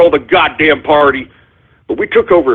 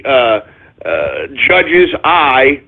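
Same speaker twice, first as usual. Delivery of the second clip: ordinary talk, nothing marked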